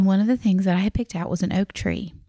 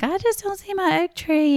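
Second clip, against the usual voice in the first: much higher, sugary and polite; put-on sweetness while hiding frustration; sweet polite voice; 12 semitones higher than usual. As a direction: play it nice and high to stay polite. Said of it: "trying to be like".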